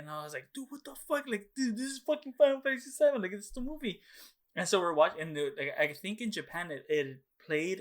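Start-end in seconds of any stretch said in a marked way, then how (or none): 0.5-4.0 put-on much higher, louder, worked-up kid; excited protest. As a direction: defend the movie like it's sacred. Arguing with parent to let him watch.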